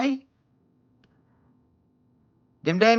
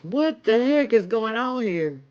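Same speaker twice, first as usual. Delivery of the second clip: usual speaking voice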